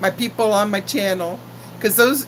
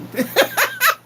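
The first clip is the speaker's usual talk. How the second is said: a short, high burst of laughter; 14 semitones higher than usual. Real, not acted. Laughs at her own jinx.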